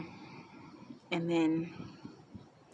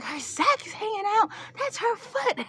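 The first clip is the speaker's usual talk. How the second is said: high-pitched panicked squeal; mock freaking out; 14 semitones higher, 3 dB louder than usual. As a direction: imitate relatives shrieking in alarm. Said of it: "My mom freaking out".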